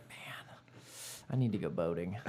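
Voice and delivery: whispered wish. Retold or imitated. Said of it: "I was like".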